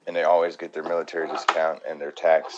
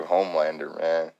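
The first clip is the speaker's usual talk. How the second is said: ordinary talk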